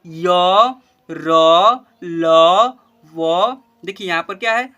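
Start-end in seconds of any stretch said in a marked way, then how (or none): 0.0-3.6 य, र, ल, व called out much louder, one by one; loud letter recital. Shown as a demonstration. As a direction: drill य, र, ल, व loudly for the class. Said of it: "पहले उच्चारण करना सीख ले".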